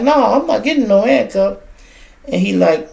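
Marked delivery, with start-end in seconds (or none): 0.0-1.6 high, loud retold refusal; mock yell. Woman refusing the cop's handcuffs, loud high mock yell. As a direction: voice her shrill protest, pitched up and loud.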